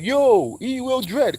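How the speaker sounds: high hailing voice of the mall dudes; calling out. Retold or imitated. Dudes in the store hailing him, calling out high. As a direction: imitate the guys shouting his nickname.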